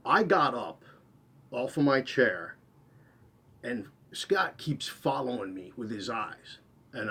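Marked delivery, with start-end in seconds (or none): none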